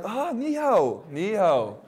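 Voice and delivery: playful mock reply; mock eager greeting. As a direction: imitate a delighted local returning hello. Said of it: "they're like".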